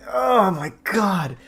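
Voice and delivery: slow, drawn-out oh my god; slow exasperated groan; pained disbelief. Real, not acted. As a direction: groan it out in disgust. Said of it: "so painful reading this".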